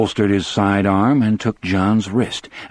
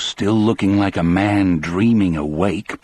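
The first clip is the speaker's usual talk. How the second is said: ordinary delivery, nothing marked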